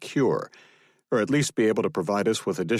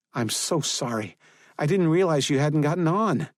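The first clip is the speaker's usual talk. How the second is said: put-on higher, lighter young woman's voice; apologetic woman, rising toward the end; Isabel's voice; 8 semitones higher than usual. A narrator's reading. Isabel apologizing to Kane after the chase, higher young woman's voice.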